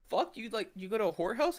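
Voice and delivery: high incredulous question; shocked disbelief. Retold or imitated. Friend replays his shocked question to roommate, high. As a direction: ask it in total shock.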